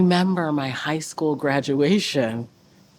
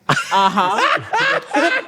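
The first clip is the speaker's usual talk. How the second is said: laughing uh-huh; 7 semitones higher than usual. Retold or imitated. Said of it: "And I was like".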